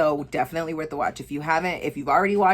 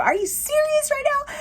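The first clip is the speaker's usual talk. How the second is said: a much higher, squealing cry of disbelief; disbelieving squeal. Retold or imitated. Vlogger reliving her reaction to the movie's twist, squealing high in disbelief. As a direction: shriek it like you can't sit still.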